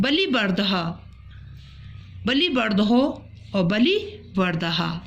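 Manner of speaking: बलिवर्धो and बलिवर्धः said slowly and distinctly; slow model pronunciation. Shown as a demonstration. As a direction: model both forms slowly. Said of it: "इन शब्दों का उच्चारण करना है".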